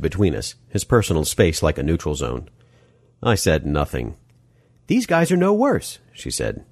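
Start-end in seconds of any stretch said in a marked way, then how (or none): 4.9-6.0 Molly, arguing. Acted. Molly's voice in narrated story.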